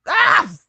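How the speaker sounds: short, loud startled "Ah!"; startled yell. Acted. Startled on opening the wardrobe.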